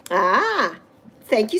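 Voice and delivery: ordinary talk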